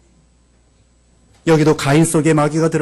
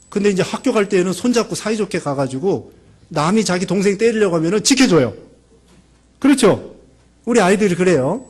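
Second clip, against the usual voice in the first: ordinary delivery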